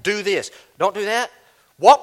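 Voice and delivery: normal talking voice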